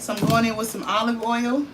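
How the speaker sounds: ordinary talk, nothing marked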